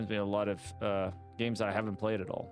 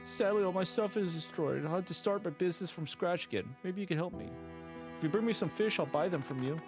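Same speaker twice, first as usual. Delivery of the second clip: higher, cheerful shop NPC; grateful shopkeeper; 6 semitones higher than usual. Acted. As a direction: thank the hero brightly.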